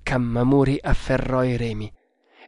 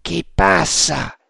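calling out; 4 semitones higher, 3 dB louder, slower than usual. A narrator's reading. Sentry voice repeats the challenge.

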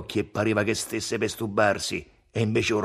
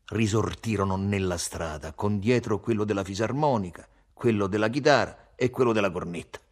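ordinary delivery, nothing marked

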